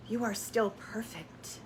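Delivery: soft unvoiced whisper, slow; whispered affirmation. Real, not acted. Coach whispering reassurance to her viewer, hushed. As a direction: breathe it to them like a secret.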